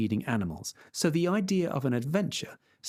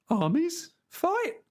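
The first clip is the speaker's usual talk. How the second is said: shocked peasant; much slower than usual. Acted. Sancho shocked.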